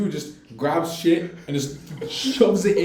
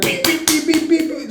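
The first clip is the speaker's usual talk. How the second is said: higher, mock horn beeps; car horn beeps; 8 semitones higher, 3 dB louder than usual. Imitating the driver honking to hurry him. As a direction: beep like an impatient car horn. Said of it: "just honking".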